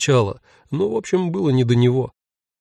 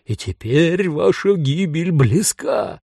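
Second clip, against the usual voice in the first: higher, swelling howl of doom; reproachful ghostly wail; 4 semitones higher, slower than usual. A narrator's reading. Ghost warning the tenant of her doom, reproachful howl. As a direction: wail ominously, stretching the final words. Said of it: "укоризненно взвыл призрак".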